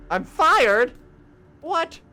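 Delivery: high, loud disbelieving cry; stunned disbelief. Acted. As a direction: react as if the floor just dropped. Shocked at being fired.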